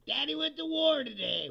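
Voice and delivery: high, loud mocking sing-song; hammed-up self-important dad; mock proud father. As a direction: lift it up and sneer at the words. Mock quoting the shooter's text.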